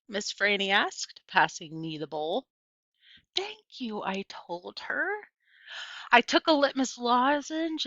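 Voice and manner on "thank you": soft unvoiced thank you; whispered thanks. A narrator's reading. Opal whispering thanks to Miss Franny. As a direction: breathe the thanks quietly.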